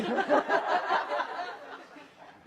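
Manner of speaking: high breathy giggle; amused high laugh. Real, not acted. Laughs after immerse.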